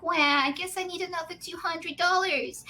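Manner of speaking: high, put-on whiny victim voice; mock whining. Streamer parodying herself as critics see her, high mock whine.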